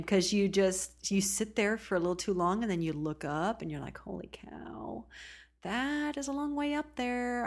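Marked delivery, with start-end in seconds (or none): 4.0-7.5 hushed slow gasp rising into a high voice; daunted awe. Retold or imitated. Tired hiker staring up the mountain, slow hushed awe. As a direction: re-enact a hiker's dismay, drawn out.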